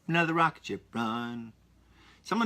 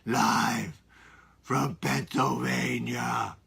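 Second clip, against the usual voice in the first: slow, drawn-out show intro; mock announcer; much slower than usual. To podcast listeners, a slow mock announcer intro. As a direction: announce it like a broadcast opener.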